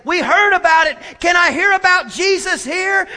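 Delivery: high, loud, excited shout; acted excitement of a crowd arriving; eager calling out. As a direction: call it out like someone running up to ask.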